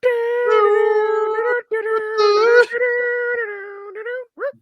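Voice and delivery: high shrieking laugh breaking out; a real cry of laughter. Real, not acted. Huge laugh.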